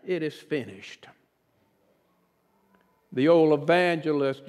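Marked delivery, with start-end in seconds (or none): 0.1-1.1 echoed back softer and lower. Retold or imitated. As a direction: let it fall back quietly like an echo. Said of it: "the Father echoed back".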